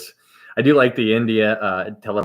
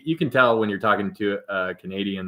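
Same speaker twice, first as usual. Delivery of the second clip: ordinary talk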